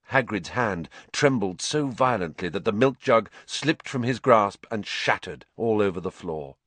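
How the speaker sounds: ordinary delivery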